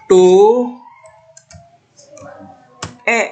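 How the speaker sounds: tuh loud, then a separate e; emphatic correct reading. Shown as a demonstration. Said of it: "begini dia".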